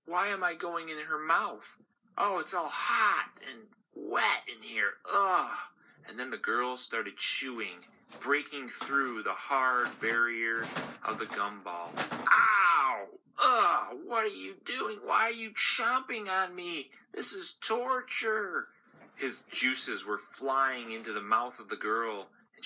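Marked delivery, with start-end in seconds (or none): 0.1-5.6 higher voice, grossed out and complaining; a tiny candy hating the inside of a mouth; disgusted gumball. Acted. Gumball inside the girl's mouth, higher disgusted voice. 12.3-14.0 loud, high cries of pain; pained yelps. Acted. Gumball being chewed, loud high yelps of pain. 14.0-18.7 much higher, pleading, slowing on the last line; tormented gumball. Acted. Gumball being chomped, much higher pleading voice.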